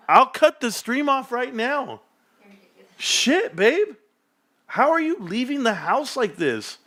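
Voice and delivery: high, drawn-out swoops of admiration; wowed. Real, not acted. Streamer gushing over partner's outfit as they leave, wowed. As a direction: gush in a stretched high voice.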